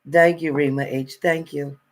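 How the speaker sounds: ordinary talk, nothing marked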